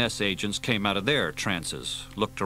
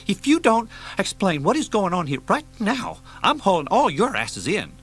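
higher, louder furious demand; angry threat; 11 semitones higher, 5 dB louder than usual. Acted. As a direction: threaten to haul them all in. Janus threatening the agents.

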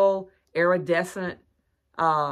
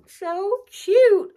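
high squeaky gush over a cute find; squealing delight; 7 dB louder than usual. Real, not acted. Shopper gushing to viewers over cute decor, high squeal. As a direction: squeal it like it's too cute to bear.

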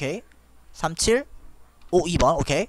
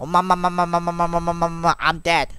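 droning monotone babbled chant; playful droned stutter into a punchline; mock repeat-after-me; 3 semitones lower than usual. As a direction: repeat the syllables on one level note at normal pitch. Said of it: "나 따라해봐".

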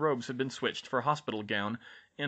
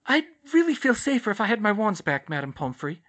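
high, louder, insistent request; pressing insistently; 10 semitones higher, 7 dB louder than usual. A narrator's reading. Hermione asking the nurse for her wands, insistent. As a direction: push politely but firmly. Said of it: "Hermione pressed".

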